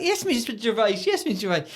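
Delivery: lightly voiced high Elmo puppet. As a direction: Elmo answering Ricky, a touch puppet-like. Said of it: "And he's like".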